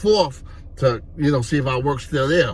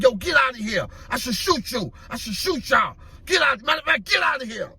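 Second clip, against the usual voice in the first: high-pitched gunman ordering them off; acted angry threat; 9 semitones higher than usual. Gunman running two teenagers off the block, acted angry threat. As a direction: drive them away with repeated threats.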